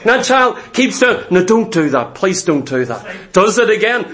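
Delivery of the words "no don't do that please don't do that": low, mild, ineffectual request; meekly ask, sinking much lower; weak pleading. Acted. Preacher mimics parent failing to stop a child, weak pleading.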